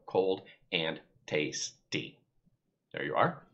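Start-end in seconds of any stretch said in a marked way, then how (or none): 0.1-2.2 Cold and tasty, slow, one syllable at a time; counting syllables. Shown as a demonstration. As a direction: tap out each syllable of Cold and tasty on your fingers. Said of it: "count 'em on your fingers and go".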